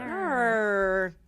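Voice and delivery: drawn-out mock Australian "no"; Australian accent. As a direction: echo it back in broad Australian. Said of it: "we always are just saying".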